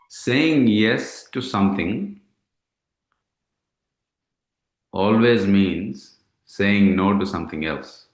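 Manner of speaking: slowly, with pauses between phrases; slow, deliberate quote. Retold or imitated. Man quoting a friend's saying to his meditation partner, slow and deliberate.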